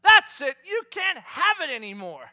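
loud high snap of fed-up dad; mock dad shout. Dad at fridge to kids, loud fed-up shout. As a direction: cut them off for good. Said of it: "and gone".

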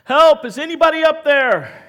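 high, full-out shout for help; desperate loud holler; yelling for help. Acted. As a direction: yell up a cliff as if hanging from it. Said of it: "He just starts yelling".

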